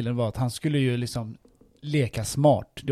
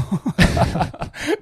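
high laugh; 8 semitones higher, 5 dB louder than usual. Real, not acted. Laughs at own joke.

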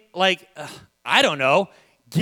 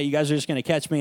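ordinary talk, nothing marked

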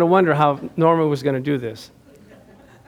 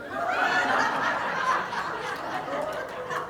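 long, high, breathless laugh; a real fit of laughter; burst of laughter; 11 dB quieter than usual. Real, not acted. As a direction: crack up in disbelief. Caught off guard by the key.

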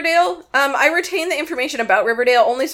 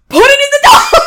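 high, loud mock cry; hammed-up shouted pitch; mock writer's outburst; 12 semitones higher, 10 dB louder than usual. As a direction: yell it like a ridiculous brainwave. Mock writers' room exclamation.

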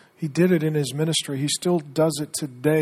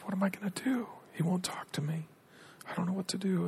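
whispered, higher and trembling; timid trembling voice; 5 semitones higher, 8 dB quieter than usual. Retold or imitated. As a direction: voice a scared abandoned wife, barely there. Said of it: "hear her voice wavering, trembling".

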